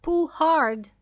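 high, loud urgent command; narrated shout of encouragement. A narrator's reading. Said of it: "she shouted".

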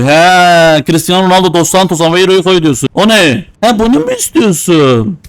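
ordinary talk

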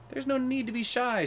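high-pitched storybook giraffe; coaxing giraffe. A narrator's reading. Gawky giraffe to hiding Hubert, high coaxing. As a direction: coax someone shy in a high voice. Giraffe coaxes hidden lion.

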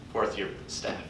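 normal talking voice

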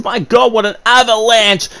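high, excited play-by-play outburst; excited commentator yell. Real, not acted. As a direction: call the avalanche like a stunned commentator. Commentator erupts at big spot.

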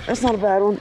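normal talking voice

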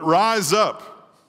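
very slow, stretched rise up; drawn out. Acted. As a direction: savour the words rise up. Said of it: "slow emphasis".

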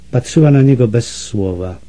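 usual speaking voice

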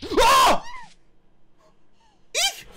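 loud, very high scream of fright; startled shriek. Real, not acted. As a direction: shriek at a sudden scare. Jump scare in game.

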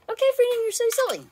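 very high, loud pet-bird voice; playful pet talk. Real, not acted. Telling her parrot he is silly, high playful pet talk. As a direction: tease the bird in a squeaky-high voice.

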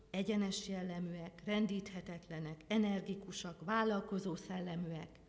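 ordinary delivery

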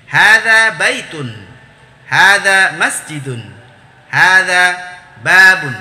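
slow, loud, drawn-out Arabic recitation; vocabulary drill. Shown as a demonstration. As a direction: stretch each hadza firmly, then land the noun.

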